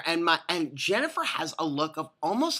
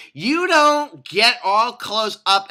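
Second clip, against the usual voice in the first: loud, slow, raised-pitch rebuke; indignant scolding; 5 semitones higher, 7 dB louder, much slower than usual. Retold or imitated. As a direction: draw it out in exasperation. Imagined scolding of lady.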